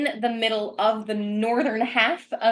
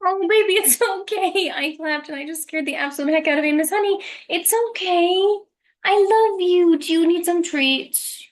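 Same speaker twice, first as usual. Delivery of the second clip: high, cooing pet voice, much higher than usual; 9 semitones higher than usual. Real, not acted. Owner comforting her startled dog, high cooing baby talk.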